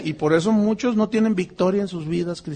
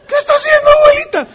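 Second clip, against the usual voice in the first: put-on much higher, louder horrified child voice; shocked child; 9 dB louder, faster than usual. Boy seeing his grandmother kill the hen, horrified cry.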